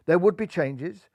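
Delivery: plain reading voice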